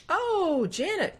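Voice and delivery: high, bright oh of recognition; light up at a familiar name; pleasant surprise. Real, not acted. Spots a friend in chat.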